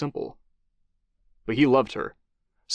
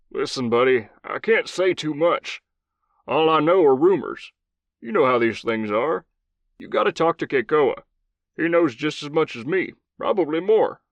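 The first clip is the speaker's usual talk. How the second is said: gruff Southern commander, higher voice; old Southern cowboy voice; 5 semitones higher than usual. Acted. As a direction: play a blunt old cowboy soldier.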